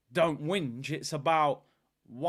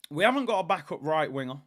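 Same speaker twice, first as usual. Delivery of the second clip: ordinary talk, nothing marked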